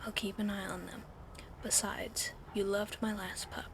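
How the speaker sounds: plain reading voice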